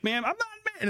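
squeaky high imitation of a meltdown; mock losing it. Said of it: "she's just losing it".

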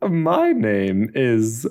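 put-on very slow German accent, drawn out. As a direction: draw out the name with a German accent. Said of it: "take it kind of in a Flula Borg direction".

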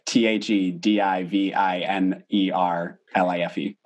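letter by letter; spelling it out. Shown as a demonstration. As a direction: spell each letter clearly. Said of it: "you do it now".

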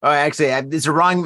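ordinary talk

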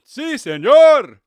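hammed-up Spanish football commentator; Spanish announcer. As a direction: belt it like a Spanish play-by-play man. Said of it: "you get to hear stuff like".

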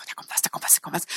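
very soft, toneless imitation; wary audience murmur. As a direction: mimic their wary thoughts under your breath. (argwöhnisch geflüstert)

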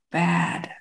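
"bad" set apart after a pause; mock emphatic BAD. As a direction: quote "bad" as one weighty, put-on word. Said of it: "not quite in that voice".

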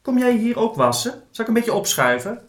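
high, sweet-natured raccoon voice; polite little animal asking nicely; very friendly. A narrator's reading. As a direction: ask kindly in a high character voice. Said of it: "Die vroeg hij heel aardig".

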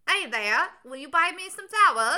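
put-on high, bright Cockney flower-girl accent. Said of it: "she had originally that".

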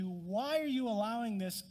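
crying out to God. Acted. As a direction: cry out the question, leaning hard on "why". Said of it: "God you're crying out to".

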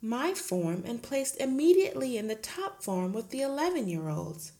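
lightly voiced gentle teacher; a hint of the meek young teacher; Miss Honey pleading. Teacher protesting to her headmistress, lightly voiced.

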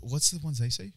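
usual speaking voice

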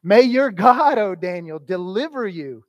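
the king's blessing, opening loud then settling; king's prayer. Retold or imitated. The king blesses Daniel as he is thrown to the lions, loud at first. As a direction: call out the king's hopeful blessing. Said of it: "he does it with his own prayer of sorts".

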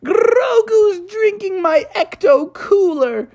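high-pitched wailing tattle to the teacher; cartoonish outraged squeal; wigged out. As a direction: wail it out, drawn out and very high. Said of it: "wigged out and he said".

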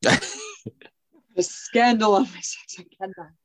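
very slow, mock-prim scandalized gasp of a voice; scandalized Hammond. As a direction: clutch your pearls, drawn out and appalled. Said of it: "Hammond's like".

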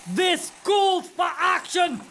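rallying shout. Acted. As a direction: call to action, shouted. Rallying elves to strike.

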